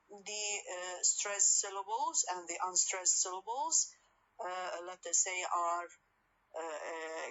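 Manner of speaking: ordinary talk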